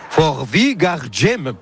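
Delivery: careful go at a Danish accent, pitch swooping; attempted Danish accent. Real, not acted. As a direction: pronounce the title in your best Danish accent. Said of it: "Oh là là, quel accent".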